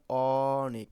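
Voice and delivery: "অনেক" with a long, loud opening ɔ; open ɔ sound. Shown as a demonstration. Teacher showing pupils the vowel অ, drawn out and open. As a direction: stretch the first vowel of "অনেক" as ɔɔ-nek. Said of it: "তাহলে অ উচ্চারণ হচ্ছে অ".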